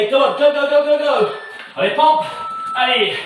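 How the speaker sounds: pushing them on, loud and high; rally the class to keep going; loud cheering. Real, not acted. Coach cheering during push ups.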